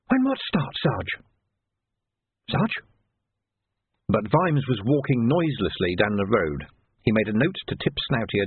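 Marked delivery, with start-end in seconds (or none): 0.1-2.8 high, questioning junior voice; puzzled young constable. A narrator's reading. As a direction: ask the sergeant in a high, lost voice.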